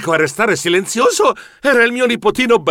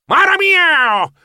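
long, high meowing war cry; cat yowl; 12 semitones higher, 4 dB louder, much slower than usual. A narrator's reading. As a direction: let the cry slide down like a meow. Pirate cat's signature yowl.

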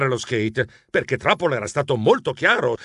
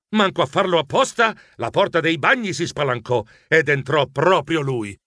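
ordinary delivery, nothing marked

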